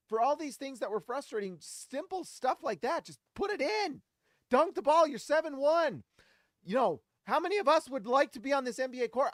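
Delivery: high, heated ranting; worked-up rant. Real, not acted. Fan ranting to viewers about the missed dunk, heated and high.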